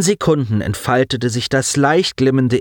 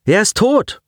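higher, cold statement; character voice; 12 semitones higher than usual. Acted. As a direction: say it coolly. Friend's voice over device.

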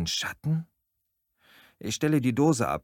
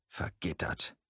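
much softer, whispered dismay; despairing whisper; 4 semitones lower, 9 dB quieter than usual. Acted. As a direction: breathe it as hope dies. Trapped, sees barred window.

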